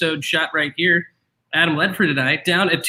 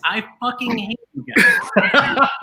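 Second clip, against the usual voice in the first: laughing; 5 semitones higher, slower than usual. Real, not acted. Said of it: "In case anyone was wondering".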